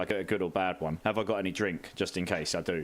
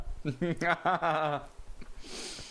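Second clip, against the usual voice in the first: pained laugh; 7 semitones higher than usual. Real, not acted. Wasabi burning his throat.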